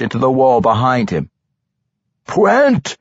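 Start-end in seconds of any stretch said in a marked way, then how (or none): 2.3-3.0 high-pitched rising holler; urgent high shout. A narrator's reading. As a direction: call the name out sharply over a fight. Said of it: "came another shout".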